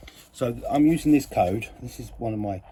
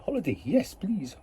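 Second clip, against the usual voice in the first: high, swooping yes please; eager reply; 7 semitones higher, 5 dB quieter than usual. Retold or imitated. Acting out eager holiday reply.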